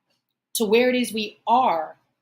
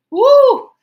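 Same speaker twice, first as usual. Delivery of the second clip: a sharp, high, loud woo to hold back tears; real emotion breaking through in a loud whoop; high emotional woo; 14 semitones higher, 14 dB louder than usual. Real, not acted. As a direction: release a loud high whoop against crying. Overcome, exhales to keep composure.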